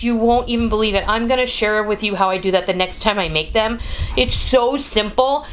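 normal talking voice

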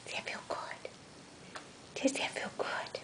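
soft unvoiced whisper to a purring cat; hushed whisper while stroking the cat. Real, not acted. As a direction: breathe it gently, just air.

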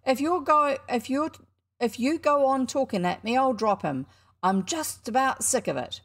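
put-on boy inside the costume, goaded and cross. A narrator's reading. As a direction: snap back, fed up. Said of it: "The goaded Ginger's voice".

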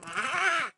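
high, loud "ah"; startled scream. Real, not acted. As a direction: scream out mid-fight. Cry during boss fight.

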